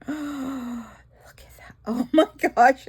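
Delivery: gasping hushed wonder; whispered awe after a gasp; awed gasp. Real, not acted.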